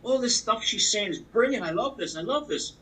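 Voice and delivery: starstruck gushing. Retold or imitated. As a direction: gush a little over the speaker on stage. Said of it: "Next thing it was a lady".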